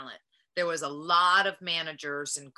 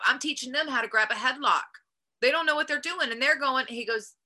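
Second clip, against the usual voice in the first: ordinary talk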